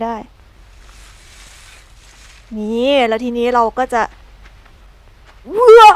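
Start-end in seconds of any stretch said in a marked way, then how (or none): 5.6-5.9 big, high, loud gasp of awe; loud wow. Real, not acted. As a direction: shout wow at the view. Sees something in game. (ร้องว้าวเสียงดังตื่นเต้น)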